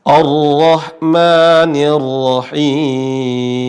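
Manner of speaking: Ar-Rahmanir Rahim slowly drawn out, ro thick toward O; measured tajwid chant; slow Quranic recitation. Shown as a demonstration. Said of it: "Ini ro berharokat fathah".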